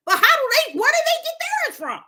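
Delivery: questioning God, high and bewildered; baffled question. Pretend. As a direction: stop short, puzzled.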